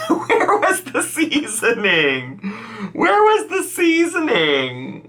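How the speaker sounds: high, slow, laughing disbelief; mock dismay. Real, not acted. Reactor asking the cook about seasoning, high incredulous plea. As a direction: draw it out in high-pitched exasperation. Mock outrage at bland dish.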